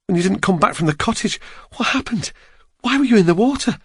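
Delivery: rising, anxious woman's questions; worried questions. Acted. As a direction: ask in growing alarm. Julia voiced by narrator.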